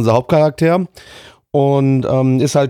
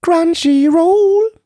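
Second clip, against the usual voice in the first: high mock pronunciation; 14 semitones higher, much slower than usual. Echoing the service name mockingly.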